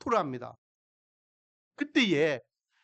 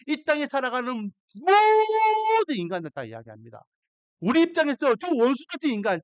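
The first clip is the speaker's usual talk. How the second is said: higher, louder sermon build; fervent preaching; 6 semitones higher, 5 dB louder than usual. Real, not acted. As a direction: press the point on the congregation. Preaching at full force. (높고 힘찬 설교 톤)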